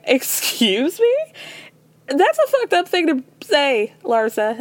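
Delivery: slow, high indignant retort; mock outrage at a rude friend; offended. Host voicing Lisa offended at Larsa, indignant. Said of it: "looking at her like".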